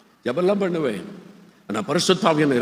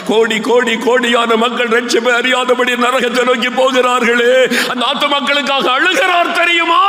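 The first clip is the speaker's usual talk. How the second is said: louder, building appeal; rising preaching; 8 semitones higher, 5 dB louder than usual. Real, not acted. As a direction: build to a cry for the lost. (உயர்ந்து செல்லும் பிரசங்கக் குரல்)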